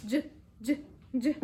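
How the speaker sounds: short "y" said like a j; isolated letter sound. Shown as a demonstration. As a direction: say "y" as a brief juh, evenly spaced. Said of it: "los sonidos".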